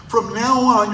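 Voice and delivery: usual speaking voice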